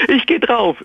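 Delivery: hoch aufschreiend, gespielt; mock cry out. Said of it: "schrie ich auf". (gespielter, hoher Aufschrei)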